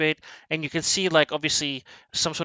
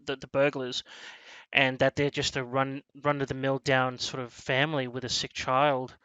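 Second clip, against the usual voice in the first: ordinary talk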